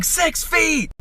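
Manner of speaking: very high shouted imitation of an old lady; mock scream. Old lady as the shopper reaches over her back, mock screaming. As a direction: shriek it high, mimicking her panic. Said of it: "she starts screaming".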